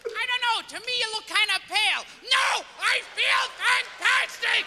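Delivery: high, strained denial; insisting he's fine. Acted. Sick man to friend, high forced cheer.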